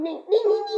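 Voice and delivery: sing-song ding ding; bell imitation. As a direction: chime like a bike bell.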